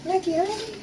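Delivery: dog's voice. Acted. He gives his dog a voice, high, slow and goofy. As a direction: speak for the dog, dopey and hungry.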